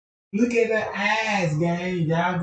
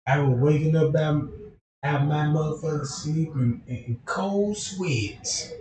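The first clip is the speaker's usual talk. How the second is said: ordinary talk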